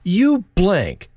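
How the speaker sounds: put-on a drunk abusing a street preacher, yelling angrily; angry drunk. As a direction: bark the insult like a furious drunk. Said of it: "a drunk from an Eastern European country".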